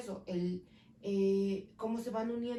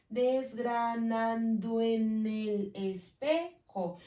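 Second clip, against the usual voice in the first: slow syllable count; 3 dB louder, much slower than usual. Shown as a demonstration. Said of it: "me dan las ocho sílabas".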